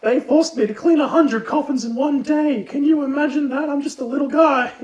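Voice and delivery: high, pitiful complaining voice; trapped girl pleads for pity in a high whine; whiny little girl. Acted. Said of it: "Gwendolyn's just like".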